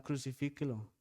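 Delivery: normal talking voice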